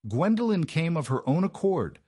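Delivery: high, loud tearful protest; voice cracking. A narrator's reading. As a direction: keep crying out, close to breaking. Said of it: "her voice cracking".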